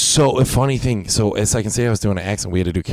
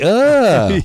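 higher, louder disgusted "Ugh"; disgusted groan; 8 semitones higher, 5 dB louder than usual. Retold or imitated. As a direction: recoil in mock disgust. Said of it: "People were like".

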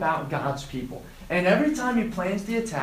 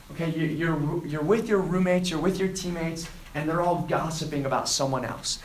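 normal talking voice

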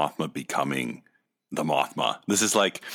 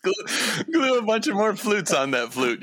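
mock designer; 14 semitones higher than usual. Mocking the designers' idea.

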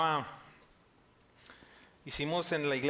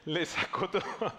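laughing; slower than usual. Real, not acted. Laughing at Jesus exposing her.

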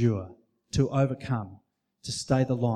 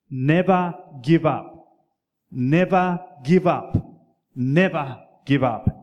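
put-on slow, louder English accent; each never give up in a slow, louder English accent, grand and rousing; Churchill's English accent; 6 dB louder, slower than usual. Preacher voicing Churchill to Harrow boys, slow loud English accent. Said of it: "He said it in an English accent".

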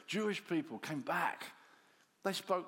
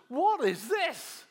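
astonished cry; 10 semitones higher, 9 dB louder, much slower than usual. Acted. Peter astonished at the Spirit.

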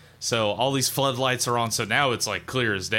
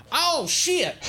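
sudden high yelp of shock; startled yelp; 3 dB louder than usual. Acted. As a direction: yelp like you just got zapped. NPC Doug yelps at fence.

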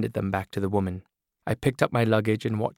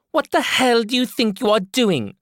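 loud, higher furious demand; outraged woman shouting higher; angry woman character; 14 semitones higher, 7 dB louder than usual. Acted. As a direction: tear into the intruder.

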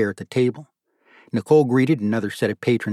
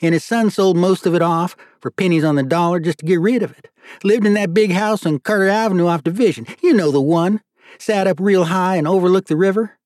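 high Southern character voice; folksy judge, scornful; 7 semitones higher, 4 dB louder than usual. Acted. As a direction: tell it like a judge dishing local history, pitched up.

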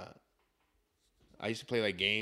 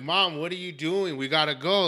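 raised, loud exasperated urging; retold alarm, pressing mom to hurry; exasperated son pushing to get going; urgent pleading; 5 semitones higher, 7 dB louder than usual. Urging sick mom to leave.

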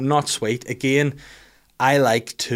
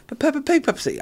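put-on three-year-old's voice; faster than usual. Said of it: "And then she was like".

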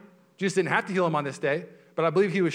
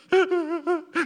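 much higher, louder delighted squeal; gushing squeal laugh; 8 dB louder than usual. Retold or imitated. Pastor mimicking a gushing churchgoer, high squealing laugh. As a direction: squeal like an overeager hugger. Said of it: "she'd be like".

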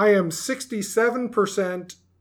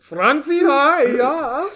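put-on higher, playful German accent; mock German accent; 6 semitones higher, much slower than usual. Playful greeting to German exchange student.

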